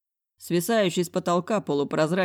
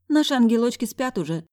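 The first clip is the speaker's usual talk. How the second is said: higher, louder motherly voice; higher doting housekeeper; fond housekeeper; 7 semitones higher, 4 dB louder than usual. A narrator's reading. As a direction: talk fondly of the little ones. Housekeeper talking about kids.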